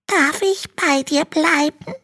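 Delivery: very high, small dragon voice; little dragon voice. Acted. Little dragon complains to Hanno in a tiny high voice. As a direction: sound like a tiny, timid baby dragon.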